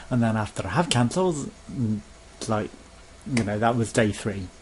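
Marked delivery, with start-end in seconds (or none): none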